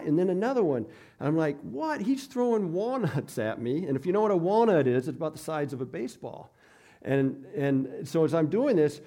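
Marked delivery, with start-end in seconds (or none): 1.6-3.9 1 surprised. Retold or imitated. Realizing coworker throws walnuts.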